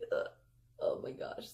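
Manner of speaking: half-whispered groan of defeat; mock whisper. Caught kid told to climb down, muttered groan. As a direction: mutter it under your breath, caught.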